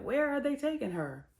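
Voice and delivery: high, intrigued question; eager curiosity. Real, not acted. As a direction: lean in, dying to know. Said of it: "And I'm like".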